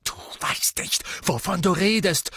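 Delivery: strained outburst climbing to a loud shout; raging. A narrator's reading. Brother tells sister she knows nothing, raging. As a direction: rage back, voice jumping high. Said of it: "tobte Ron".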